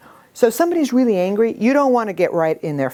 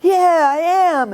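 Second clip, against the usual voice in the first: put-on angry child; 10 semitones higher, 4 dB louder, slower than usual. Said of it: "And he's like".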